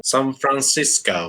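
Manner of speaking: mock American accent. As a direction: say the city with exaggerated American vowels. Echoes city name in foreign pronunciation.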